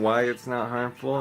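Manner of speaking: ordinary delivery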